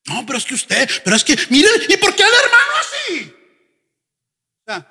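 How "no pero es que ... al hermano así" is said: rising to a much higher, laughing protest; mock the complainer, climbing up high; stubborn member's whine. Pastor imitates a stubborn member protesting, high and whiny. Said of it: "cabezón".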